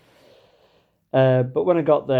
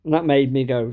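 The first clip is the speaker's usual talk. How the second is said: ordinary delivery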